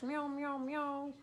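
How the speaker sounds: high playful meows; mock meowing. Owner meowing playfully at her cat, high and light. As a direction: meow at the cat like a kitten.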